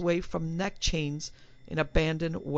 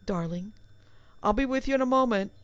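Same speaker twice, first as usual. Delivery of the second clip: ordinary delivery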